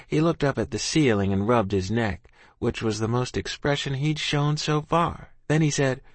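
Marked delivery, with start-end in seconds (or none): none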